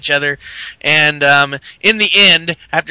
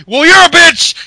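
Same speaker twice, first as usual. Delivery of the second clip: shouted insult, higher and louder; angry retort; 12 semitones higher, 8 dB louder than usual. Retold or imitated. Kane shouting back at Seth in anger. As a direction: snap back loud. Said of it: "Kane's like".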